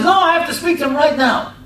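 high, loud insistent demand; a customer raising his voice at the counter. Retold or imitated. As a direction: push back hard, won't be refused. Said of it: "he raises his voice".

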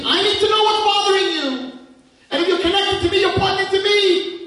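high, loud, exclaiming with love; God pleading. Pretend. God urging the sad girl to talk, high and loud. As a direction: beg her to tell what's wrong.

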